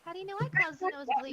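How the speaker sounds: loud, fast, high protest; flustered outburst. Acted. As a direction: blurt it out caught red-handed. Isabel caught out, flustered.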